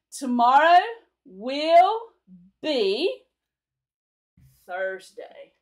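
each word stretched and set apart, loud; slow word-by-word. Shown as a demonstration. Teacher modelling the future tense slowly for students. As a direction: say it word by word for learners. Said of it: "Will be is future tense".

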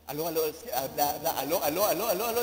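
low, repeated practice hellos; voice warm-up. Shown as a demonstration. Sleepy pastor practicing before answering the phone, low warm-up. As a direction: test your voice before picking up. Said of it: "hablan antes de contestar".

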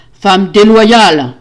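loud, drawn-out accusing yell; furious yell. A narrator's reading. Husband throwing his wife down, furious yell. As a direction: howl it slowly in rage. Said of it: "hurla-t-il".

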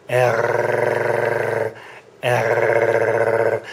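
"err" rasped way back in the throat, tongue still; throaty r. Shown as a demonstration. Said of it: "My tongue is not vibrating there".

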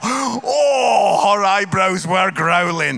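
loud laughing exclamations; laughing loudly. Real, not acted. Comedian laughs loudly recalling his wife's face. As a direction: crack up while telling it.

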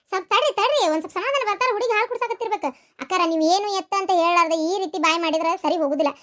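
usual speaking voice

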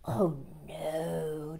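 soft sly fox voice; soothing liar's denial. Acted. The fox reassuring the gingerbread man, soft and sly. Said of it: "declared the fox".

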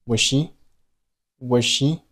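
was she with the z of was dropped into she; was she merged. Shown as a demonstration. Teacher showing was she linked. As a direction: keep one sound between was and she. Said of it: "读成了".

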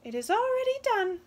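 high, loud, quick wren; a tiny bird granting the wish loudly. Acted.

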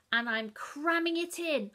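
excited. A narrator's reading. Greedy eating.

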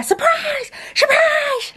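very high squealed surprise; mock surprise shout. Stepmom springing the birthday party on Dad, high squealed surprise. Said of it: "is gonna say to him".

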